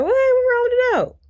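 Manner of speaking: very high, loud shocked squeal; retold shock at the dead puppy, squealed. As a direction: squeal it out in disbelief. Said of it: "I'm like".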